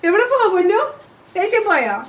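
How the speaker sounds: high, playful questioning; teasing pet talk. Real, not acted. Teasing the pet about vanished anger.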